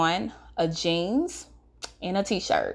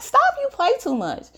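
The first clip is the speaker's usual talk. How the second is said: higher, light laughing stop; brushing him off as a joke; teen telling a friend he plays too much; playful protest; 11 semitones higher than usual. Retold or imitated. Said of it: "So I'm laughing".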